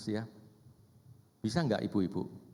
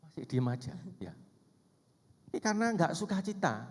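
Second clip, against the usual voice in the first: ordinary talk, nothing marked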